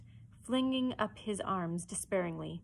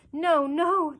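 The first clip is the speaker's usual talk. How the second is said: high, loud pleading wail; piteous cry; 9 semitones higher, 10 dB louder than usual. A narrator's reading. Prisoners beg Hook to spare them, piteous cry. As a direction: cry out pitifully for mercy. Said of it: "they cried so piteously".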